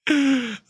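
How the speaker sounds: high, sudden gasp; a startled intake of breath; real shocked gasp; sharp gasp. Real, not acted. Sudden alarm.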